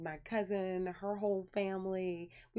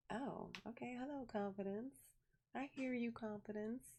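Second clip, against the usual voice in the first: awkward polite greeting; 4 semitones higher, 7 dB quieter than usual. Retold or imitated. Retells awkward phone greeting.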